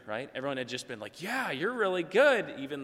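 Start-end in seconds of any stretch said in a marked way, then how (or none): 1.2-2.5 slow, high, louder mock flattery; gushing praise. Family flatters a bad singer, hammed-up gushing. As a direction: lay the fake encouragement on thick.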